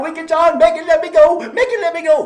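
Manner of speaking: high, loud desperate begging; a trapped little devil howling for release; pleading yell. Acted. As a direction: wail to be let go. Devil stuck crying to be freed.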